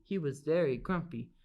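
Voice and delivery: ordinary delivery, nothing marked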